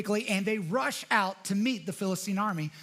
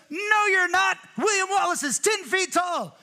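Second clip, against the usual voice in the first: loud, much higher yell from the back of the crowd; heckler's shout; 9 semitones higher, 6 dB louder than usual. Retold or imitated. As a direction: shout the doubt across the field. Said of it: "this dude from the back goes".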